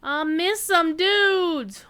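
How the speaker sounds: loud, slow, high exclaim; excited. Real, not acted. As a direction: draw out the excitement. Excited at missed enemies.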